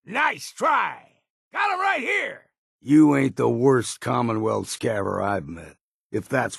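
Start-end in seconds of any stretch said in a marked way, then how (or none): 0.1-2.5 much higher, yelled taunt at a foe; gleeful high battle shout; combat taunt shout. Acted. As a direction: bark it across the battlefield.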